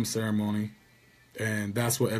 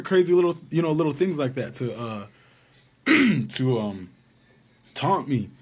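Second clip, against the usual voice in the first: ordinary talk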